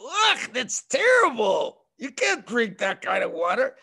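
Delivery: wrinkle your nose at the water; disgusted kid. Retold or imitated. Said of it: "You're gonna go".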